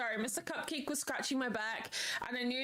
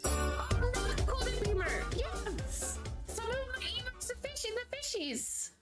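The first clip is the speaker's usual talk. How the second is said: excited squeal; 11 semitones higher than usual. Real, not acted.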